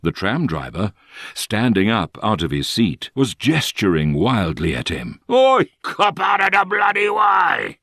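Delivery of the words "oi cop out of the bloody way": angry Cockney shout. A narrator's reading. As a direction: bellow it in broad Cockney like a furious driver. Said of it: "he shouted angrily in a distinctive Cockney accent".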